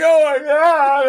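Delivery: high, loud, drawn-out mock cry; hammed-up tearful outburst; mock wail. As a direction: wail it like you're losing it over a game. Retold breakdown at game's tearful scene.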